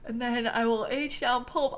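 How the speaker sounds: talking through a yawn; sleepy yawn mid-sentence. Real, not acted. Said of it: "I'm sorry for yawning".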